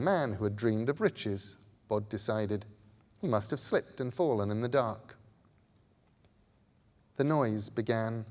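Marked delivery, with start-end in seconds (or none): none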